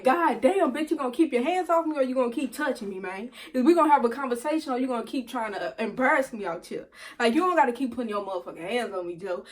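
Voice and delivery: fast, heated rant; indignant telling-off. Retold or imitated. Girlfriend pushed onto the sidewalk, indignantly snapping back. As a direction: rattle off an exasperated warning.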